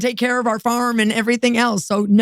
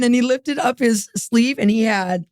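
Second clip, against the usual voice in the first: ordinary talk, nothing marked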